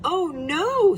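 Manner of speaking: high, alarmed gasp; Mama Bear's alarm. A narrator's reading. Mama Bear finding the open door, high alarmed gasp. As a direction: rise up high in shock. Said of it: "said Mama Bear".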